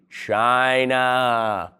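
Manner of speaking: China stretched long and even, a slow mock-knowing reveal; drawn-out punchline. Shown as a demonstration. Comic inviting the audience to chant China, drawn out. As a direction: let China hang long for the crowd to join in. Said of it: "Say it with me".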